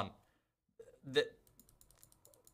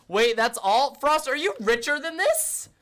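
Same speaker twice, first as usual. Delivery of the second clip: 1 surprised; 10 semitones higher, 6 dB louder than usual. Real, not acted. Shocked at friend's small stash.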